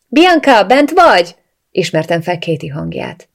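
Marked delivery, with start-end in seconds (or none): 0.1-1.3 raised, higher voice calling out to someone inside; calling through the door. A narrator's reading. Katie calls to Bianca through the bathroom door. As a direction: call through a closed door, pitched up and loud.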